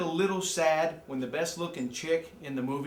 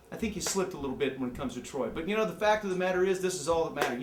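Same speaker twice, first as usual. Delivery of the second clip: ordinary delivery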